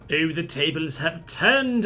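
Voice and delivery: slow, theatrical gloat, stretching every word; mock-triumphant villain drawl; hammed-up gloat. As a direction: savour it like a pantomime villain. Gloating as battle swings his way.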